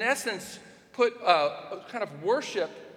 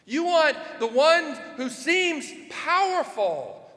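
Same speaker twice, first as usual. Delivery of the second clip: emphatic preaching shout; 8 semitones higher, 4 dB louder than usual. Acted. Preaching emphatically on wanting power.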